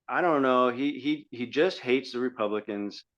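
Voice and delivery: ordinary talk, nothing marked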